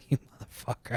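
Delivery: half-laughed, breathy curse under the breath; a fond, amused insult, nearly voiceless; laughing curse. Real, not acted. Annoyed at friend's request.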